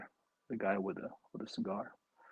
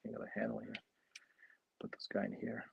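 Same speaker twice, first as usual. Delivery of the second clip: unvoiced whisper to himself; 2 semitones lower, 15 dB quieter than usual. Real, not acted. Building the model, whispering to himself. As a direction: murmur it under your breath while working.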